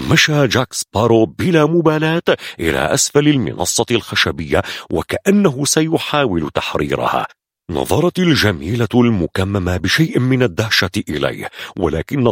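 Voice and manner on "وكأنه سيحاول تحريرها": ordinary delivery, nothing marked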